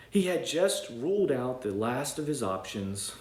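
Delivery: ordinary delivery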